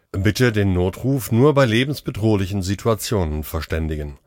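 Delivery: ordinary delivery, nothing marked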